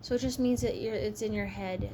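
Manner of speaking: ordinary talk, nothing marked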